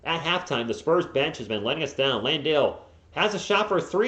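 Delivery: usual speaking voice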